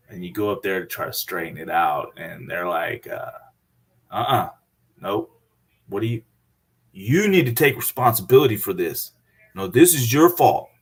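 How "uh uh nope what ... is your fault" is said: a mock stern refusal, ending louder; dismissive bank staff. Bank staff blaming the customer, mock stern refusal. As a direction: shut him down, hint of scolding.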